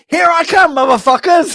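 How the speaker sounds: high, loud triumphant movie-hero yell; gung-ho battle cry. Acted. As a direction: holler it as if charging back in. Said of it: "I said".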